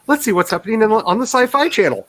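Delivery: high-pitched change of subject; airy, high self-quote; mock breezy. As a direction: skip off brightly to something else. Acts out fleeing to another story.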